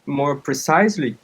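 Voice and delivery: ordinary delivery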